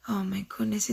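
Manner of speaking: normal talking voice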